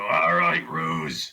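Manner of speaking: low-pitched warrior voice; orc battle challenge. Pretend. Player's orc challenges the ruse, spear on shield, low voice. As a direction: drop the voice low, squaring up to a foe. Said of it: "my spear against my shield and go".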